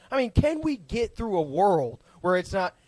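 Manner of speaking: ordinary talk, nothing marked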